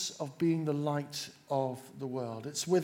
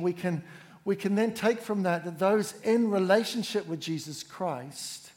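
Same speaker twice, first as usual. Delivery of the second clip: normal talking voice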